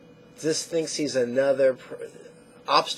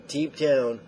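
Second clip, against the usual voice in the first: ordinary delivery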